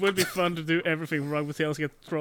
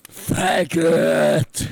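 loud shouted insult; villain's yell; 9 dB louder than usual. Acted. Villain shouts at the Dusk Hunter while attacking, loud yell. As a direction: bellow it as a battle cry. Acting villain's battle cry.

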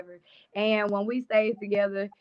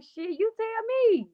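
much higher, drawn-out mock disbelief; retold incredulous comeback; 11 semitones higher, slower than usual. Pregnant woman answering her partner's "How?", retold high incredulous comeback. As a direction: throw the question back, high and slow.

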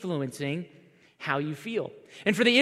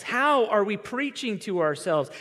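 ordinary talk, nothing marked

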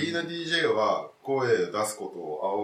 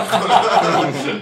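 big laugh; 8 semitones higher, 14 dB louder than usual. Real, not acted. Laughing at fans' tweets.

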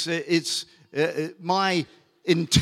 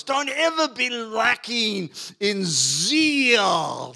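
emphatic scripture reading; 6 semitones higher, much slower than usual. Acted. Pastor to congregation, slow emphatic scripture reading. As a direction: lean on every word as if from the pulpit. Pastor quotes Paul to the Romans.

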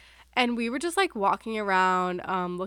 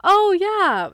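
high, loud mock realisation; acted-out loud "oh, yeah"; retold eager recognition; 11 semitones higher, 10 dB louder than usual. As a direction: imitate him suddenly catching on, pitched up. Retold boy suddenly recognising the city.

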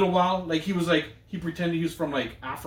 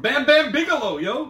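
high excited call of the name; mock excited call; 10 semitones higher, 5 dB louder, slower than usual. Quoting a viewer's comment, mock excited fan call. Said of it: "Somebody said".

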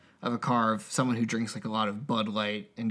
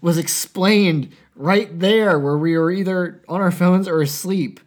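mock angry fan; 5 semitones higher, 7 dB louder than usual. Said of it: "sitting there screaming".

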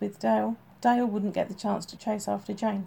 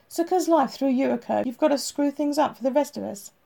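higher, louder, an irritated scolding; glaring rebuke; 5 semitones higher, 5 dB louder than usual. A narrator's reading. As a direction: let him know he's fed up. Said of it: "Redfern glared".

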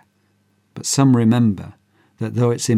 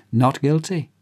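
usual speaking voice

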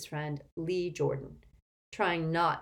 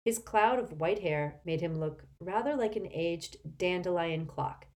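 ordinary delivery

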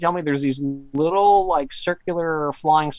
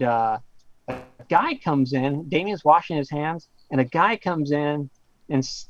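normal talking voice

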